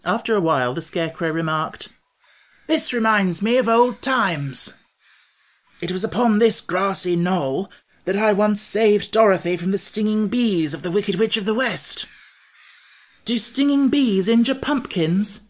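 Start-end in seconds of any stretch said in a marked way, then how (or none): 2.7-12.1 high, loud, proud storybook monarch; Scarecrow, reminiscing. A narrator's reading. Scarecrow telling companions of old exploits, high and grand. 13.2-15.4 high, loud, worried question; fearful. A narrator's reading. Jack dreading stinging bees, high and fearful. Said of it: "glancing around fearfully".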